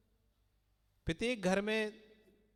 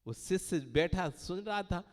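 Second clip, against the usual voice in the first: normal talking voice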